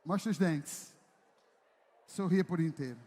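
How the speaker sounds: ordinary talk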